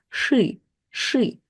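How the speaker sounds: "shi" with hard sh and retracted i, like shy-ih; "shi" modelled as Russian шы; hardened vowel. Shown as a demonstration. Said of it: "it will kinda harden".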